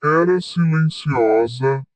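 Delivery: usual speaking voice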